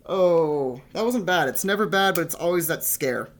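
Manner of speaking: loud relieved exclamation. Real, not acted. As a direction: let the relief burst out. Relief after insertion.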